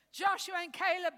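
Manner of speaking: usual speaking voice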